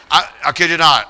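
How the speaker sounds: ordinary talk, nothing marked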